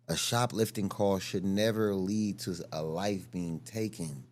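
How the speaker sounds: ordinary talk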